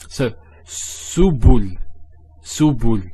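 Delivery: subul said slowly, each syllable clear; slow careful subul. Shown as a demonstration. Teacher models subul slowly for students, syllable by syllable. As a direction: sound it out for the students. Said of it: "So su, bu".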